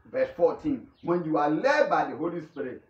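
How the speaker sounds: ordinary talk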